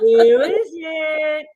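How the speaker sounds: high, slow and laughing; suspenseful. Acted. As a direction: drag out the suspense. Suspense before the name.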